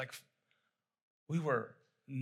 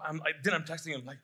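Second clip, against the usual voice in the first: normal talking voice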